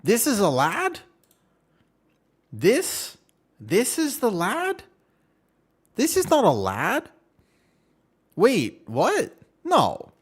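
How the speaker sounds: loud, high-pitched disbelief, rising questions; incredulous outburst. Real, not acted. Streamer seeing what a lad is, loudly incredulous. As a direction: exclaim in baffled shock at each discovery. Shocked by the search result.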